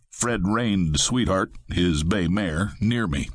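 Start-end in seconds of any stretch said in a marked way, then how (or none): none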